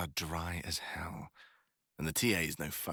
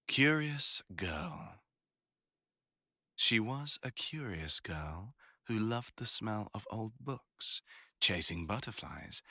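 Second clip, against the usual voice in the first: slow, higher, deliberate reading from the book; reading aloud; 3 semitones higher, slower than usual. Shown as a demonstration. Reading aloud from the classmate's favourite book, slow and deliberate. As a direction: savour the words like a first reading. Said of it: "let's see".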